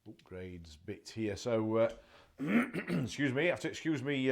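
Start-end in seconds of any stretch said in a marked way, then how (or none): 1.9-3.0 loud chesty throat clear; harsh throat-clearing grunt. Real, not acted. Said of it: "grunting a little bit still".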